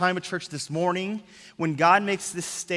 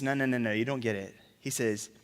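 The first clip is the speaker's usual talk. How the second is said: low gentle correction; 5 semitones lower, 5 dB quieter than usual. Retold or imitated. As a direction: calmly set them straight. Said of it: "But Jesus says".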